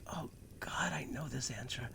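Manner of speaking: hushed whisper to oneself; whispered thought. Retold or imitated. Student racking their memory during a test, whispered.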